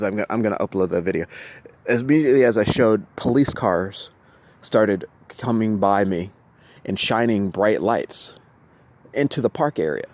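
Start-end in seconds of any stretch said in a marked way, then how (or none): none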